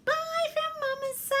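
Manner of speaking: high, loud goodbye call; big, high-pitched farewell; cheery sign-off. Real, not acted. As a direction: sing out the goodbye brightly. Signing off to child viewers.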